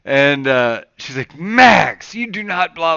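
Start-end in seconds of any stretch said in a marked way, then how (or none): 1.4-3.0 raised-pitch, firm mock telling-off; imitating scolding mom. Kelsey tells Max off for swearing, imitated scolding. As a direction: lay down the law as mom. Mother scolding child, retold.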